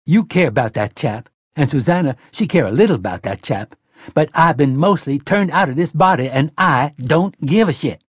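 crude, no arguments allowed; harsh, crude Detta. Acted. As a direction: Detta Walker snapping at Mia. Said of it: "that was all Detta Walker".

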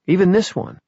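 high-pitched, earnest insistence; earnest daughter. Acted. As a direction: press the praise on a doubting father. Daughter praises another of his drawings.

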